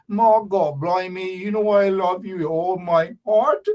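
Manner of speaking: broad mock Bajan declaration; swooning in exaggerated Bajan accent; Bajan girl. Bajan girl declaring love, broad Bajan accent. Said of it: "a Bajan girl".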